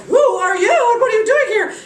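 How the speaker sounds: high, loud startled question; alarmed demand. Acted. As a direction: cry out at the intruder. Queen confronts a stranger.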